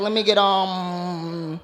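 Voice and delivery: drawn-out, undecided ordering; hesitant shopper. Acted. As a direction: stall on a long lingering um. Acts out a shopper deciding.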